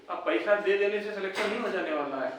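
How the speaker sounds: normal talking voice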